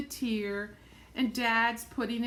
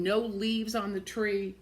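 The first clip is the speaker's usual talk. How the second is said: ordinary delivery, nothing marked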